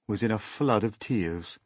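ordinary delivery

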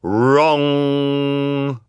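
loud, high, long-held bong; imitated clock strike, sustained tone; booming clock chime. A narrator's reading. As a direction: ring out like a huge clock. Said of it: "thundering through the room".

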